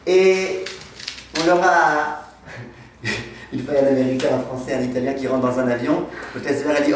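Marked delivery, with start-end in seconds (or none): none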